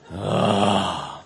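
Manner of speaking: deep Esau groan; long, low exasperated "ah". Acted. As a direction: groan from the bottom of your range. Esau answers Jakob's fussing.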